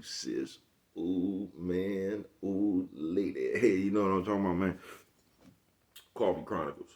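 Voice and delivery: ordinary talk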